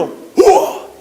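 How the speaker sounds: high, loud drawn-out hoot; imitated odd kiai. Pupil demonstrating his shout for the head instructor, odd high yell. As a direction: punch out a peculiar taekwondo cry. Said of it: "Ese grito".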